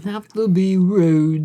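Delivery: slow, drawn-out mock Sheffield accent; put-on Sheffield accent, lingering on the words. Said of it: "with that Sheffield accent".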